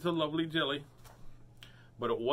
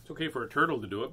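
ordinary delivery, nothing marked

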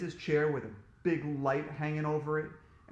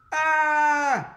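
long, high, loud scream of fright; shouted scream; 12 semitones higher, 13 dB louder than usual. A narrator's reading. Boy sees pliers on the windowsill, screaming. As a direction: scream "Aaah!" at full voice. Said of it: "I shouted".

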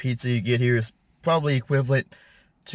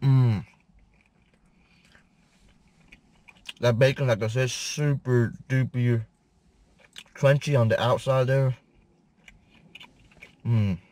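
chewing between words; muffled by food in mouth; mouth full. Real, not acted. Seen 1: Bite at -9; jaw and lip motions continue through the words.

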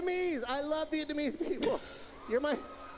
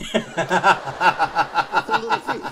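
slow, loud, low put-on growl; hammed-up gangster voice; mock thief voice; 10 semitones lower, 12 dB louder, much slower than usual. Impression of tiny fighter.

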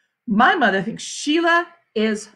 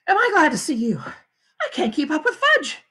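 higher-pitched harried mom; relieved, harried mother; 7 semitones higher than usual. Acted. Mother tells Peter she can't keep up, higher harried voice. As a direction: greet with relief after a chase. Mother worn out chasing Fudge.

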